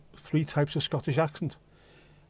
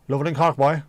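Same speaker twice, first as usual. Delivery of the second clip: louder mock Cork accent; 2 semitones higher, 6 dB louder than usual. Showing how they talk in Cork, mock Cork accent. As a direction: put on a punchy Cork lilt. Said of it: "talking like they do in Cork".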